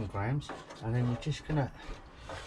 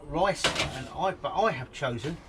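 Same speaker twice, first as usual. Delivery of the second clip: ordinary talk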